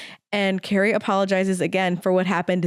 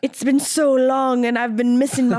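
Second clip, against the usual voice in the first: put-on playful Irish-accented old-fashioned plea; 3 semitones higher, 3 dB louder than usual. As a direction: lay on a mock Irish lilt. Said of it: "He has an Irish accent".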